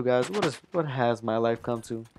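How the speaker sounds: ordinary delivery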